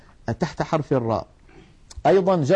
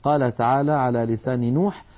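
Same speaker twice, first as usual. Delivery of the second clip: normal talking voice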